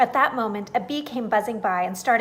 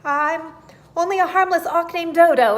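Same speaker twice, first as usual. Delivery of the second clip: high, loud, nervous squeak of a frightened bird; trembling auk; 7 semitones higher, 10 dB louder than usual. A narrator's reading. A sweating auk pleads with the tiger, loud and scared. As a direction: blurt it out in a panic. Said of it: "began to tremble and sweat ran".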